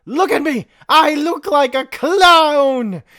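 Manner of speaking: high, loud angry yell; shouting. Acted. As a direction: yell it in outrage. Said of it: "Xigbar shouted again".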